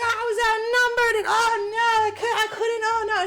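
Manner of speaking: high, loud mock wailing; hammed-up helpless protest; frantic excuse. Acted panicky excuse in story.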